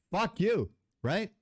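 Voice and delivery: normal talking voice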